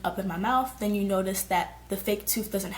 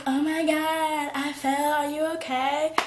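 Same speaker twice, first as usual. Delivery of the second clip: higher, drawn-out worried friend; mock alarmed concern; 6 semitones higher, slower than usual. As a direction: imitate a friend checking on you. Friend's reaction after the fall, acted.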